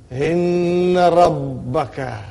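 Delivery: Inna held long on the n, slow and weighty; emphatic Inna. Shown as a demonstration. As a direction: stretch Inna, then land on Rabbaka. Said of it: "if I were to emphasize".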